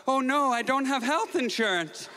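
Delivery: high, strained panic mid-fall; panicked thought. Retold or imitated. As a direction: blurt it in sudden alarm. Panic mid-fall on basketball court.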